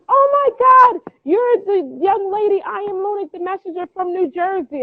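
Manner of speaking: high, slow, gushing fan voice; squealing with delight, drawn out at the start; excited fan. Retold or imitated. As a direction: gush like a starstruck caller. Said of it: "She says".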